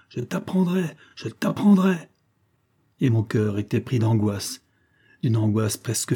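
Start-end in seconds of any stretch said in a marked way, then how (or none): 0.1-1.0 cri courroucé. A narrator's reading. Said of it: "voix ferme, courroucée du colonel criant". 1.1-1.9 répétition criée, plus aiguë et forte; martèle la menace à pleine voix; cri furieux. A narrator's reading. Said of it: "voix ferme, courroucée du colonel criant".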